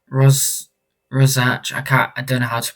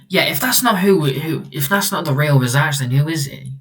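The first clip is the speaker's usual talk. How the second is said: higher-pitched, questioning challenge; challenging question; 5 semitones higher, 7 dB louder than usual. Acted. Doubting he is the real Razak, raised challenging voice. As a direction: start high and press the question.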